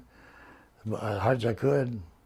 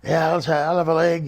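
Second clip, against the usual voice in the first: higher, louder imitated reply; calling back; 6 semitones higher, 8 dB louder than usual. Limo passenger asks the front seat for chicken, calling back.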